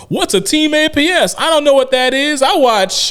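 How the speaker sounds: loud, high-pitched heckler's shout; mock yelling out. Fan making a show of belittling the team, yelling out. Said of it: "he just kinda yells out".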